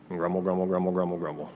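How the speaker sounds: low, quiet grumbling murmur, a bit rushed at the end; mock grumbling. Preacher mimics the grumbling crowd's complaints, low and quiet mock grumble. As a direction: mutter it like a sulky crowd.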